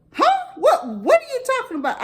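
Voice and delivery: high, astonished squeak; a niece's high-pitched shocked huh. Retold or imitated. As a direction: hit it way up in disbelief. Niece shocked at laxative story.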